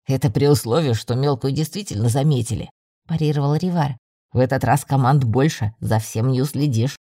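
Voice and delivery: low male character voice; parry the argument in a deep voice; deep-voiced teammate disagreeing; countering young man. Rivar counters the blond man.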